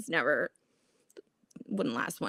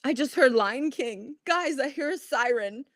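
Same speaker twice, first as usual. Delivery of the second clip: mock frantic announcement; 6 semitones higher, slower than usual. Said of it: "I'm like".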